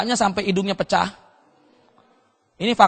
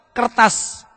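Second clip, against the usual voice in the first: ordinary talk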